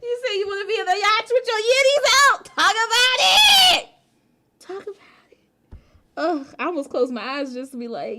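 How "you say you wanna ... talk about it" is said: high, laughing, loud words; laughing hard. Real, not acted. Streamer cracking up at a chat comment, high laughing voice. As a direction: talk through real laughter.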